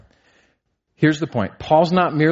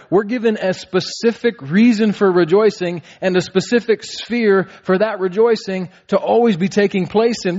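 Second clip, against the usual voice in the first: higher, impassioned preaching climax; preaching at full force; 7 semitones higher than usual. Acted. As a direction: build the congregation toward Christ.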